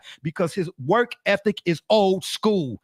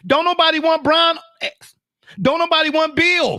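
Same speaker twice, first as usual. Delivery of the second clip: higher, louder dismissal as a GM; mock GM shout; 8 semitones higher, 4 dB louder than usual. Pretending to be a GM turning down Beal, loud. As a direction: bark it like a GM refusing a trade. Playing a GM.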